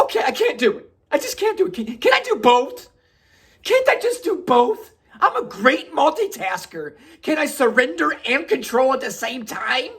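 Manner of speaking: high, loud, pleading wail; hammed-up whining protest. Begging to surrender and control at once, desperate mock whine. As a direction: whine and bargain like you can't cope.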